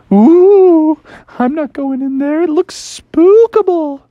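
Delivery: high, slow spooked whimper; hammed-up fright; mock scared. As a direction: hang back, afraid to go in.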